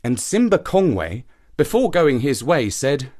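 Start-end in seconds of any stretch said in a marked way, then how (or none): none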